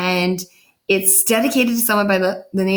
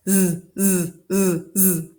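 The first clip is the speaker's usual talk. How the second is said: snoring; slower than usual. A narrator's reading. Father asleep, teacher imitates his gentle snoring. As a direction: breathe out a sleepy snore. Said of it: "asleep like this".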